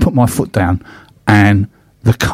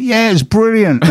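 much higher, delighted mock Cockney cop; mock Cockney accent; 12 semitones higher than usual. Police officer praising the fast car, in mock Cockney. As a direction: cheer it like an impressed Cockney copper. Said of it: "I don't think they said it in that accent".

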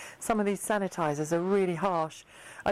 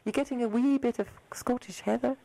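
a lightly put-on Scottish accent, pitched up; 5 semitones higher than usual. Host teasing the doctor about Scottish heather, in a light mock Scottish accent. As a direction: ask it in a playful mock Scottish lilt. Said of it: "That's my Scottish accent".

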